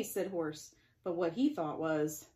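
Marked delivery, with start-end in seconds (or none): none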